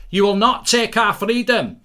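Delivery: loud, slow, high defiant battle cry; mock Braveheart defiance; defiant rallying cry. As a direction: roar it like Scots defending their freedom. Voicing defiant Scots before match.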